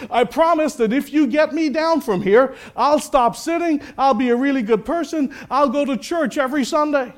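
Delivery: high-pitched acted pleading; desperate bargaining. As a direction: plead and promise anything to be rescued. Jack bargains with God for rescue.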